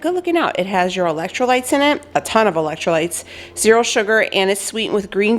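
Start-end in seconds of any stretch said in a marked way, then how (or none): 0.0-0.6 much higher, breezy thanks; high appreciative quip. Retold or imitated. Praising the drink's electrolytes, in a high quip. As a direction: toss off a high, chirpy thank-you. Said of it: "I was like".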